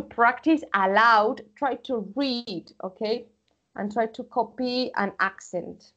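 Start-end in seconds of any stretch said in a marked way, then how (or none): none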